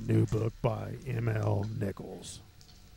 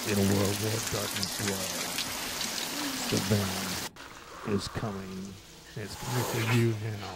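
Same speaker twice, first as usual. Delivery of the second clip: horror trailer voice; 4 dB quieter than usual. Acted. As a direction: draw out the doom like a movie trailer. Horror book trailer narration.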